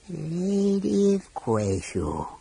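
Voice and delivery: slow, stately dragon voice. A narrator's reading. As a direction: address her slowly, coldly. Dragon addresses Goldmoon.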